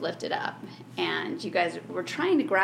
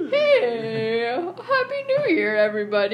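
much higher, louder goofy greeting; high silly ogre voice; 3 semitones higher, 6 dB louder than usual. Acted. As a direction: chirp it out like a cheery ogre. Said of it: "And he's like".

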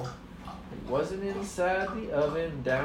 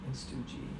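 plain reading voice

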